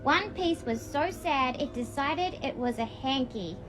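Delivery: gentle storybook reading voice; reading to children. A narrator's reading. Reading a picture book to younger alters, gently. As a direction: read it like a bedtime book.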